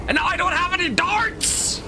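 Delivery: very high screech of complaint; frustrated wail. Real, not acted. Gamer out of ammunition after a hit, frustrated wail. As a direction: wail it at the top of your range. Frustrated at losing all darts.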